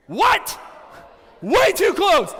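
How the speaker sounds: high, loud shocked cry; mock shock. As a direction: react as if horrified by the photo. Shocked seeing close photo.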